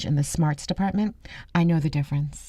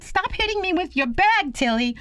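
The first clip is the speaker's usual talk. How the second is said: high-pitched mock protest; protesting man. Man under pocketbook attack to Tilly, protesting. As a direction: plead comically as the man being hit.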